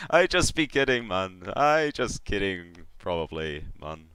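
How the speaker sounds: joking catfish baron, starting high and loud; playful soul collector backing off; Baron Vol. Acted. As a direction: tease in character, then let it tail away. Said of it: "leans his head back and just makes this big throaty laugh".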